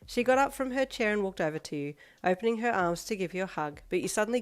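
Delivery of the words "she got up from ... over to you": ordinary delivery, nothing marked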